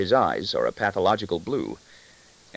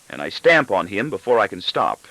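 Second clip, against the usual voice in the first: plain reading voice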